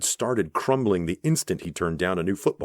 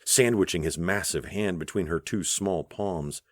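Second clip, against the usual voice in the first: usual speaking voice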